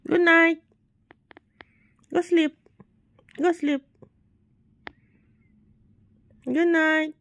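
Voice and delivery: high, loud good-night call; insistent high bedtime call; sending to bed. Real, not acted. Sending the sleeper back to sleep.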